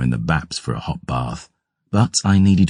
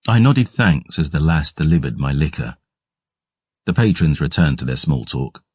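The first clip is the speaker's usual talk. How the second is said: usual speaking voice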